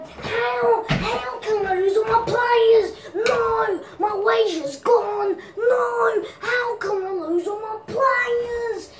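despairing gamer. Acted. Impersonating a gamer losing all his players, wailing. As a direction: wail in mock despair.

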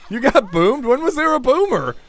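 high, loud surprised question; incredulous outburst. Real, not acted. Player finding a teammate got boomed, loud high surprise. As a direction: burst out high in disbelief.

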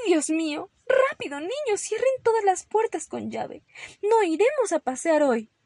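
high, loud, urgent orders; panicked mother. Acted. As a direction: raise the alarm to the whole household. Mother panicking, ordering doors locked.

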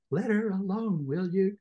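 a light plea to stop the fight; Joe interjecting. Acted. Joe asking Orlick to leave his wife alone.

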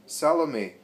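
loud shocked cry of a name; shout. A narrator's reading. Host spotting the intruding girl, shouting. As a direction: call her name out sharply. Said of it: "shouts Chusa".

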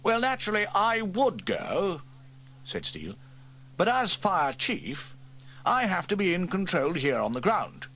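wriggle out grandly; pompous chief. Acted. Fire chief dodges climbing the ladder, pompously.

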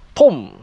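a loud mock pop of a fish strike; imitated splash. Retelling the noise Paul heard, mock splash sound. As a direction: punch out the sound like a sudden splash.

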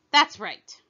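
loud, satisfied shout. A narrator's reading. Queen approves the soldiers' answer, shouting. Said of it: "shouted the queen".

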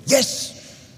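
sudden high, airy squeal of yes; eager kids' yes. Retold or imitated. Kids answering dad's offer of a plane ride, eager mock squeal. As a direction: imitate excited children jumping at the offer.

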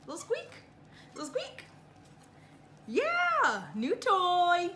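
high loud doggy squeal; high coo. Real, not acted. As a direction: squeak it like the toy. High cooing about the toy.

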